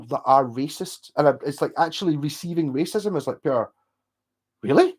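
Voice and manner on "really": high, loud rising question; acted shocked double-take; shocked disbelief. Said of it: "it's almost a shock".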